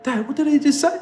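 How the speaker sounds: put-on very high-pitched kid voice; imitated child. Kids questioning dad about workmate's remark, high imitated child voice. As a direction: pitch it right up as a startled child. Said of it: "my kids are like".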